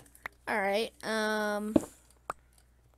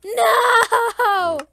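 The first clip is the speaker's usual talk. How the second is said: high, loud wail of dismay; dismayed shouting; 9 semitones higher, 10 dB louder, slower than usual. Real, not acted. Gamer swamped by mobs, high loud dismayed shout. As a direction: cry out in defeat, very loud.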